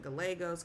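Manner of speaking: "Gallegos" as guh-LAY-gos with an L sound; English-style "Gallegos", middle syllable LAY; American mispronunciation. Shown as a demonstration. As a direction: say "Gallegos" the anglicised way, quickly, L not Y. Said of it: "people would pronounce it".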